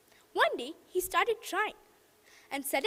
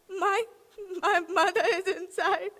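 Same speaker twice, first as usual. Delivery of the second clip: faltering, tearful; crying. Acted. Shilpa crying to the inspector at the dark room. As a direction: stammer it through tears. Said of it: "She started crying again".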